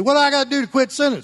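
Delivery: high, fast whiny questioner; mock churchgoer question. Pastor voicing a worried churchgoer, high and quick. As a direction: rattle it off like an anxious sinner. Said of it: "You say".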